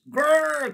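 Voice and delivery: loud, high-pitched growl; beast growl. A narrator's reading. Crowd of beasts enraged, growling. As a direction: let out a rolling growl. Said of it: "came the growls".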